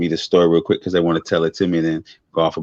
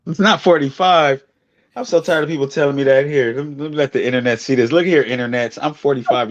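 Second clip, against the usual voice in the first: indignant protest; 7 semitones higher than usual. Real, not acted. Insisting to friend he really is forty-five, exasperated. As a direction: pitch jumps up in fed-up protest.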